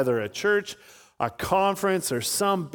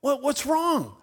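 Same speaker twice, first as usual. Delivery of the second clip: high, fussing voice of a tiny lady; alarmed concern, played up; worried trainer; 10 semitones higher than usual. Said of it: "And she goes".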